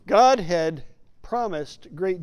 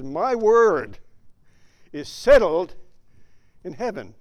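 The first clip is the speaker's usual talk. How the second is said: emphatic declaration; 7 dB louder than usual. Retold or imitated. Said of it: "God says".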